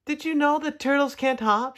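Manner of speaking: high-pitched called-out question; shouting. A narrator's reading. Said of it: "shouted Tudley as he hopped".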